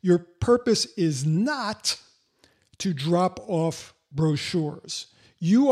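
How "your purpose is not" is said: slow, measured build to an emphatic "not"; stress on NOT. Real, not acted. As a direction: spell it out slowly, punching "not". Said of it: "I have to emphasize the word not".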